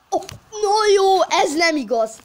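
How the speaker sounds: high, loud, drawn-out outcry; frustrated yell. Real, not acted. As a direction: cry out in disbelief. Missed chance frustration.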